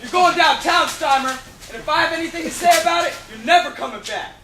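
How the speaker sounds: furious police bark; angry shout. Acted. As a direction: yell at her as you arrest her. Policeman arresting Molly.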